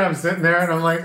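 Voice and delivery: normal talking voice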